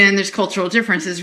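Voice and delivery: usual speaking voice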